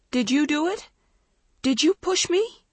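accusing through tears, rising; crying accusation. A narrator's reading. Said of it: "Dawn cried through her tears".

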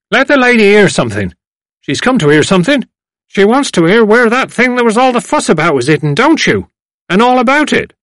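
put-on loud, high old man cutting in; fed-up elder shushing the singer; impatient elderly gentleman. A narrator's reading.

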